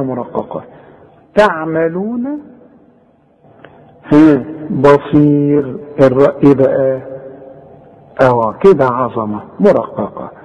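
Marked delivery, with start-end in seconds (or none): none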